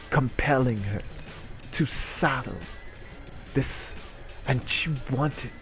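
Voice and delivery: ordinary delivery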